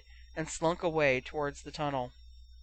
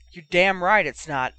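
loud retort, a touch of harshness; harsh laughing retort; 8 dB louder than usual. A narrator's reading. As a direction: throw it back forcefully, mildly scornful. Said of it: "Oliver laughed harshly".

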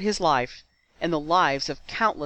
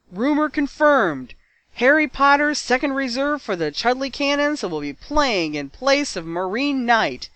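loud, high stadium announcer call; amplified announcer; 8 semitones higher, 6 dB louder than usual. Acted. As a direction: boom it out over a crowd. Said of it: "Lee Jordan's amplified voice".

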